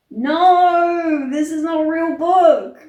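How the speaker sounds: loud, high cry of disbelief; exasperated outburst. Real, not acted. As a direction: protest loudly and in real disbelief.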